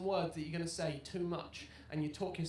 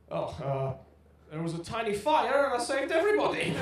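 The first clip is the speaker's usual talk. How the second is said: put-on louder, confident drunk excuse; a hint of the drunk Scottish man's boast; 3 semitones higher, 8 dB louder than usual. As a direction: bluff it out brightly. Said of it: "brilliant man went".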